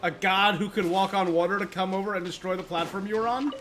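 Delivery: normal talking voice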